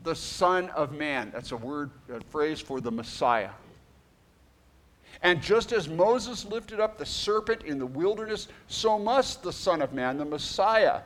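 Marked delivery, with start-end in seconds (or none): 0.0-1.3 scripture reading. Acted. Reads scripture aloud. 5.2-11.0 scripture reading. Acted. Reads scripture aloud.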